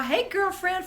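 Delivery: high, delighted hey; excited. Real, not acted. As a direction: gush a hello to a friend. Greets a friend in the chat.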